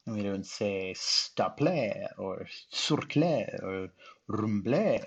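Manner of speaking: slow staple and surcle said stah-pleh and soor-kleh; French-sounding wrong readings of staple, surcle and rumble; wrong French-style pronunciation. Shown as a demonstration.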